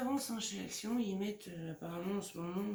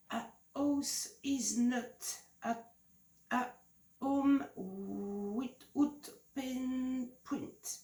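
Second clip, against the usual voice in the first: slow, halting English with a thick French accent; heavy French accent; 4 semitones higher, 4 dB louder, much slower than usual. Real, not acted. Reading an English pad title to viewers, slow French-accented English. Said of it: "mon anglais, hein, est déplorable".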